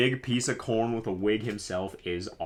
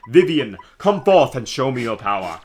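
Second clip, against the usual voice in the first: grand summons; 3 semitones higher, 10 dB louder than usual. Pretend.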